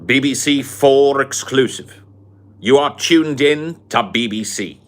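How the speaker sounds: put-on a pompous British gentleman sneering at the colonies, posh British accent; Sargon's posh British accent. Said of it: "I can't do the accent anymore".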